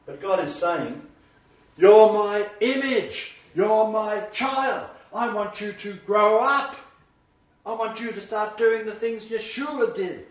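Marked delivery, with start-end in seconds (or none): 1.8-10.3 high, slow pleading voice of God; God's tender plea. Acted. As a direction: plead like a loving father.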